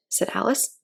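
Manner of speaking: plain reading voice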